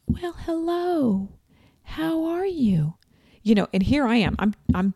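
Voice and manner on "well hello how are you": much higher, slow, sugary greeting; sweet healer voice. Retold or imitated. Acupuncturist welcoming the sweaty newcomer, sweetly high. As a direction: greet her like a gentle kind host. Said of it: "She's very sweet".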